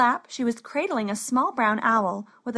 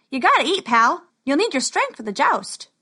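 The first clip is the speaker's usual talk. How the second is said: loud, higher-pitched pep talk; brisk urging; 4 semitones higher, 4 dB louder than usual. A narrator's reading. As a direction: push your nervous pal to eat. Wallace urges nervous Max to eat.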